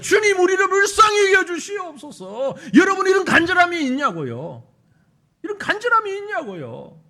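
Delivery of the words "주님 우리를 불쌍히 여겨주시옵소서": fervent outcry. Acted. Earnest prayer cry.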